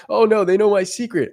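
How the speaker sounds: high, loud hammed-up panic; mock panic. Mocking a fearful guy to viewers, hammed-up panic. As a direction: squeal it like a caught-out guy. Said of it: "They might be going".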